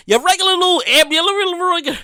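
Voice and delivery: ordinary talk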